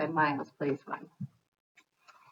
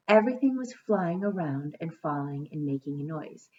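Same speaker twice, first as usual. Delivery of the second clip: storybook narration; story reading; 3 dB louder than usual. A narrator's reading. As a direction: read to children at an even pace. Reads a storybook aloud.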